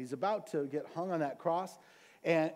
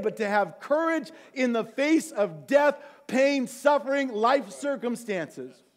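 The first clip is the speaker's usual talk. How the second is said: louder, higher preaching push; fiery preaching; 4 semitones higher, 5 dB louder than usual. Real, not acted. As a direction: drive the congregation with full-force preaching. Preaches with rising force.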